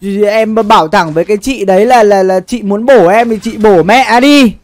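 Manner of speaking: ordinary talk, nothing marked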